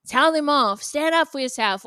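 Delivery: put-on loud, hyped-up cheering girlfriend; cheering her friend on, mock hype. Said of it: "glorifying it and being like".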